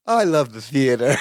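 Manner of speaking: low put-on character voice with a laugh; Balky. Balky answering Wendy's Hamlet line, low imitated voice. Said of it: "And then Balky goes".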